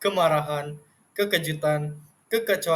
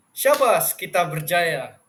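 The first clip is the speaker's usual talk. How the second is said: exclamation; 5 dB louder, slower than usual. Shown as a demonstration. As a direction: call it out in triumph. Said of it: "nada atau intonasi seruan". (nada seruan penuh semangat)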